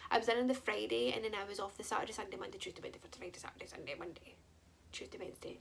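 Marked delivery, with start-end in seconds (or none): 2.1-5.6 fading. Real, not acted. Listing days off, fading.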